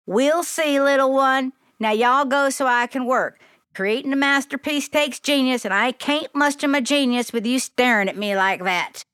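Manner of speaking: put-on Southern lady telling a kid to hold still; Southern accent. A narrator's reading. Sophia measures Glory and tells her to stand still, Southern accent. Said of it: "In a thick Southern accent".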